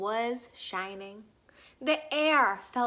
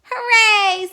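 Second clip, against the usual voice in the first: much higher, louder cheer; cheering cub; 9 semitones higher, 12 dB louder than usual. A narrator's reading. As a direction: cheer like a delighted little cub. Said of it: "said Baby Bear".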